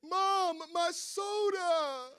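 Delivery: put-on high, loud, drawn-out child's wail; wailing little boy. Son to his mother over spilled soda, acted childish wail. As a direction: cry out to mom in dismay, stretching the last word. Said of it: "his lips started quivering".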